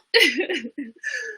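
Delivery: high-pitched laughing fit. Real, not acted. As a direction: crack up at your own joke. Cracks up after "We out.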